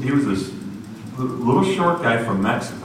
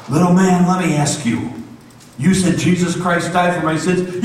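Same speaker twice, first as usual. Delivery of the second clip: lightly voiced big man; 8 semitones higher, 4 dB louder than usual. Giant worker questioning Miguel, weighty. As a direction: ask it heavy and serious. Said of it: "He says".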